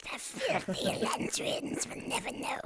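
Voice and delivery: sly servant whisper; whispering hunchback. Acted. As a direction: hiss it to the master. Continuing the hunchback bit, whispered.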